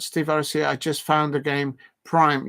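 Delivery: ordinary talk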